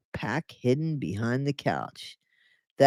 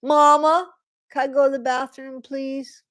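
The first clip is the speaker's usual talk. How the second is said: higher, pleading little voice; pleading child; 10 semitones higher than usual. Retold or imitated. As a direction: beg Mama like a small child. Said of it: "without asking permission".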